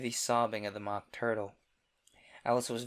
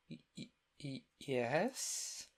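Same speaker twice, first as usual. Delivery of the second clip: hesitant stammered yes; unsure, stumbling reply; doubtful; 3 semitones higher, 5 dB quieter, much slower than usual. Acted. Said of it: "said Alice doubtfully".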